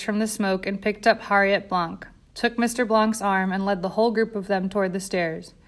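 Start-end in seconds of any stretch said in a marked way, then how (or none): none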